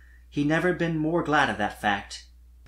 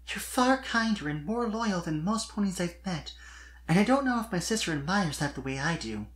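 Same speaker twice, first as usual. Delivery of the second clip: higher voice, hesitant and soft; shy young mare confessing; 5 semitones higher, 3 dB quieter than usual. A narrator's reading. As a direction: confess it, stumbling a little. Sweetie praises Spike.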